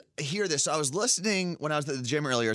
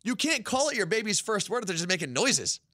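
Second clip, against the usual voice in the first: indignant rant; 7 semitones higher than usual. Real, not acted. Rant about fake baby words.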